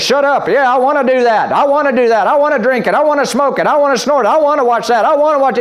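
the flesh whining for what it wants. Retold or imitated. Preacher voicing the flesh to the congregation, mock-greedy. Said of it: "And your flesh says".

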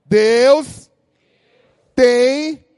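Taubaté accent. Shown as a demonstration. Pastor demos how a Taubaté native says it, Taubaté accent. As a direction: call it out like a proud taubatiano. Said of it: "como um bom taubatiano".

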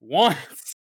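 loud grunted one-word line; mock video game grunt; imitating the silent hero. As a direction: bark the single word like a game hero in a cutscene. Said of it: "all I've heard him do at this point is go".

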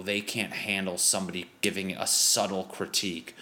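ordinary delivery

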